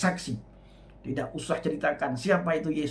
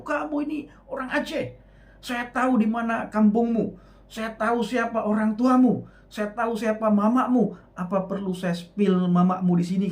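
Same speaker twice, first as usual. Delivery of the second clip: higher, louder threatening retort; angry accuser; 7 semitones higher, 4 dB louder than usual. Retold or imitated. Preacher imitates angry Acehnese commenters threatening him, higher and louder. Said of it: "Oh, mereka marah".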